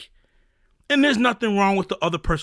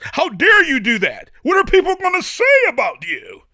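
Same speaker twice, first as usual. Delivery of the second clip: shouted accusation, much higher and louder; rising shout; 9 semitones higher, 6 dB louder than usual. Retold or imitated. The critic in your head yelling about your past, shouting. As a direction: build to a shout of self-doubt.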